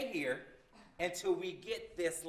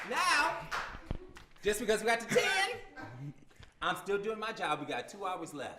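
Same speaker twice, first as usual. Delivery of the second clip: usual speaking voice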